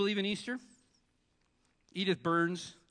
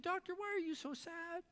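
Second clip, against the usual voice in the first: voicing Edith; 5 dB quieter than usual.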